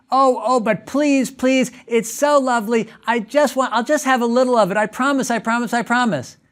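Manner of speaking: put-on high, quick pleading kid voice; child begging again after being told no; whiny begging child. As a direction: beg and promise over and over. Parent imitates begging child.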